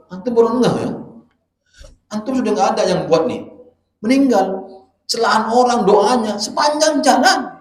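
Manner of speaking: loud and high, hammering each phrase; fiery preaching. Real, not acted. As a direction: preach it at full force. Preacher hammering point loudly.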